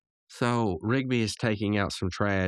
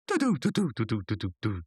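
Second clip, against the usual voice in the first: vocalise an eighties tom roll; imitated synth toms. Imitating toms in eighties synth pop, sung drum fill. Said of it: "you know, the toms".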